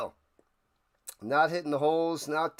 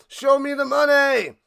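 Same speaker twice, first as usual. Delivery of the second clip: shout; 8 semitones higher, 8 dB louder than usual. Acted.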